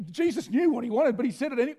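usual speaking voice

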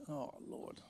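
breathy whisper under the breath; whispered oh Lord. Real, not acted. Pastor whispering to himself before the stairs. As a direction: whisper it, dreading the climb up. Nervous at the top unit.

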